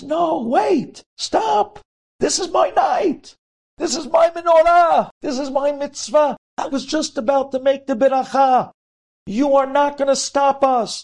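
put-on high, loud cry of a frantic boy; desperate pleading shout. A narrator's reading. Boy begs the soldiers not to take the rabbi, shouting. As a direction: beg them to stop, shouting.

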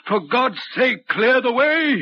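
urgent plea. Retold or imitated. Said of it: "as though he were trying to signal me".

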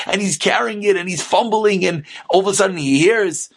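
ordinary talk, nothing marked

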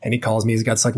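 ordinary talk, nothing marked

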